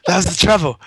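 ordinary talk, nothing marked

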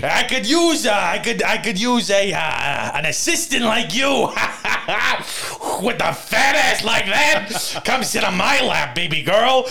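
leer through laughter like a creep; greaser tough guy. Retold or imitated. Continues sexist boss act.

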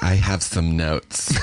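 slow, drawn-out mock executive voice; annoying network executive. Network woman after the table read, annoyingly drawn-out. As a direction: stretch each word as an irritating note-giver. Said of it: "Is that a real voice like that?".

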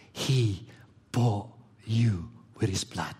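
hushed, slow whisper. Shown as a demonstration. Preacher whispering to the congregation: slow whisper. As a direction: breathe it out like a secret. Preacher hushing a key phrase.

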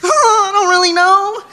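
high, giggly and flustered; giggling and squirming. Retold or imitated. Flustered at strangers asking her type, giggling high. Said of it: "giggling and squirming and being like".

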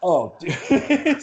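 high, laughing "dude" with a bashful chuckle; embarrassed laugh. Real, not acted. Runner laughing off the question about crying, bashful laugh. Asked whether he cried.

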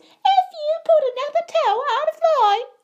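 very high, loud mimicked scolding; shrill, piercing. A narrator's reading. Bully mimics friend's scolding mother, shrill and piercing. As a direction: shriek it as a mocking impression. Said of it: "He put on a shrill, piercing voice".

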